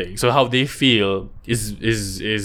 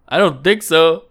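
ordinary talk

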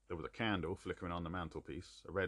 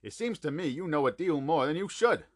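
suspicious; 8 semitones higher, 8 dB louder than usual. A narrator's reading. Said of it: "with a frightened face and suspicion".

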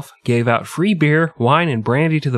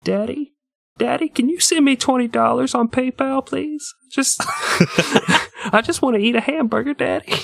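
put-on much higher pleading little-kid voice to daddy; whiny begging child; 13 semitones higher than usual. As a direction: beg daddy like a small child, high and whiny. Grown child begging dad for money.

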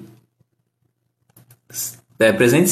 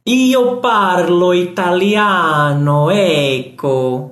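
lightly hammed-up Italian, slow; playful put-on Italian accent; mock Italian; 3 semitones higher, much slower than usual. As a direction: fake soap-opera Italian, drawn out. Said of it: "Meu italiano é da Globo".

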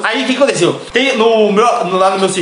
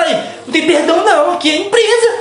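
high-pitched mock reprimand; exaggerated high telling-off, edged with laughter; imitated scolding; 8 semitones higher than usual. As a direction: mimic an offended coworker, pitch climbing. Imitating the offended woman's scolding.

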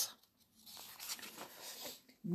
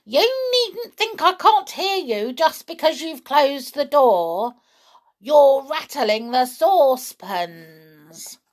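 shrill scolding grandma; 7 semitones higher, 4 dB louder than usual. A narrator's reading. Grandma scolding from next room.

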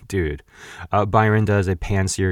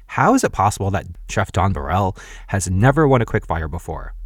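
ordinary talk

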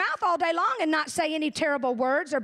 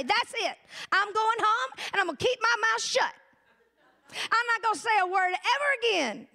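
higher-pitched, determined declaration; emphatic vow; 6 semitones higher than usual. Retold or imitated. Churchgoer vows after a sermon to stay silent, mock resolute. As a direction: swear it off with mock finality.

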